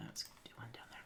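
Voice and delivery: whispered aside. Real, not acted. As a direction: say it under your breath.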